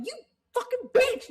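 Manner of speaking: high, loud furious outburst; angry yell. Retold or imitated. Fan yelling at a wrestler on screen, angry and loud. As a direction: yell it like your favourite just got insulted. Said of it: "I actually was angry".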